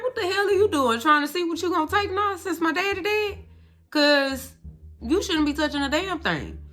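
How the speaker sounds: high, loud imitated scolding; confronting the aunt over figurines, high loud scold; mock angry self. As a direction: snap at the aunt for touching things. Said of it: "And I'm like".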